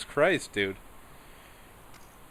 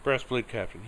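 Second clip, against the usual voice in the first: ordinary delivery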